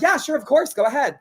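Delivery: high, chirpy yes; chirpy mock eagerness; eager agreement. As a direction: retell her own bright eagerness. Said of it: "And I'm like".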